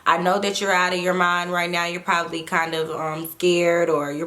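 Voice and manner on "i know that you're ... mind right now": usual speaking voice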